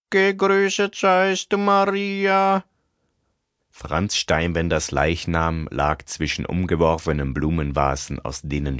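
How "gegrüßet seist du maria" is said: high, solemn murmured prayer. Acted. Prayer heard at wake.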